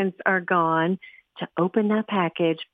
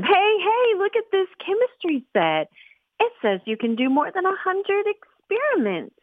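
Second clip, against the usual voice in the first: eager distraction; 13 semitones higher than usual. Acted. Sister distracting parents.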